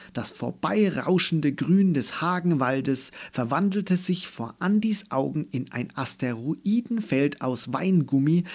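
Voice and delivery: usual speaking voice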